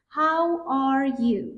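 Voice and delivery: "How are you" stretched very slowly, stress on "How"; slow demo. Shown as a demonstration. Teacher modelling a greeting slowly for students. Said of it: "احوال پرسیه".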